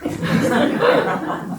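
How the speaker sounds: imitated prayer chant, from hushed to high and slow; mock earnest praying. Monk imitates the old devotee praying hard, mock earnest chant. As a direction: mimic a devotee repeating the name in prayer. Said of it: "진짜 기도를 열심히 했대".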